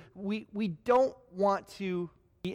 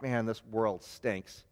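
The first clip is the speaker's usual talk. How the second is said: glum; 9 semitones lower, 5 dB quieter, slower than usual. Retold or imitated. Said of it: "you take off your mask".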